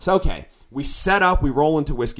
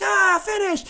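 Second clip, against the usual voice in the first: cheering crowd; slower than usual. Acted. Audience answering the singer's question, imitated crowd cheer. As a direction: imitate a crowd crying out from afar. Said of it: "the crowd's like".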